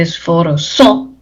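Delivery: "esforzó" slowly, stressed on the last O; stress on final O. Shown as a demonstration. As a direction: draw out "esforzó" and land on ZÓ. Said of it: "acento en la O por aguda".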